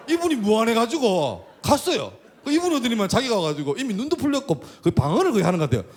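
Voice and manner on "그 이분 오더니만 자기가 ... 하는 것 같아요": normal talking voice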